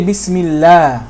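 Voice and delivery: "Bismillah" with light l and a as in "cat"; slow "Bismillah", final vowel thin and fronted; thin lam. Shown as a demonstration. Teacher showing the word after a kasra, thin lam. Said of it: "then you make it thin".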